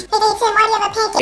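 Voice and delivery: high, squeaky call across the room; mock classmate call. A classmate calling out to Tim, squeaky and high. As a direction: squeal it out like a kid tattling.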